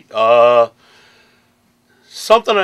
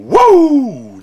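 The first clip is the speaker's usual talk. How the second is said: Ric Flair woo; 12 semitones higher, 8 dB louder than usual. Retold or imitated. Ric Flair signature whoo.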